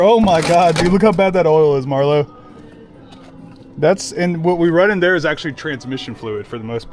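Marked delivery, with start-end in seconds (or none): 0.0-2.3 excited. Real, not acted. Gross oil drained from diff.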